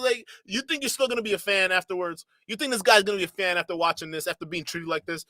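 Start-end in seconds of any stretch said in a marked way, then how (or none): none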